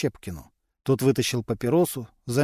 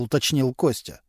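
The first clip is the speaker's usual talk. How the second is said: ordinary delivery, nothing marked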